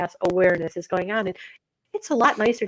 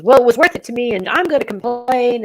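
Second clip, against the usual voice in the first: raised, louder, indignant retort; indignant complaint; 5 semitones higher, 5 dB louder than usual. Retold or imitated. The lady in line, retold, snapping back at him loudly. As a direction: push back hard at the man. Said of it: "She says".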